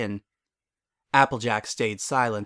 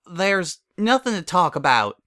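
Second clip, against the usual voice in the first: a touch of stubborn refusal, louder; 7 semitones higher, 4 dB louder than usual. Acted. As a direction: shut the topic down, turning away. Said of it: "she said stubbornly".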